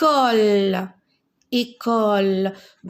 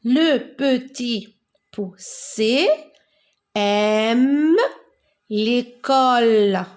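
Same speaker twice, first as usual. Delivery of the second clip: drawn-out teacher read-aloud; slow model reading; slower than usual. Shown as a demonstration. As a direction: read it out slowly, word by word. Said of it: "lecture lente modèle". (lecture lente, mot par mot)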